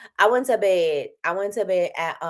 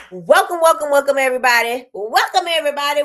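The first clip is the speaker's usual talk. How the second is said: high, louder, sing-song welcome; cheery welcome; 8 semitones higher, 6 dB louder than usual. Real, not acted. Host opens her show with a loud, high welcome. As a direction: greet the whole audience brightly. Greeting livestream viewers.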